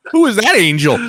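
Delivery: high, loud retold wonder; acted astonished admiration; amazed. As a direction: replay your awe, raising pitch and volume.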